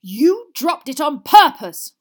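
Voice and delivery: slow, high, very loud accusation; furious roar. A narrator's reading. Father accuses his son, furious roar. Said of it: "roared Mister Weasley".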